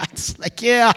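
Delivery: ordinary delivery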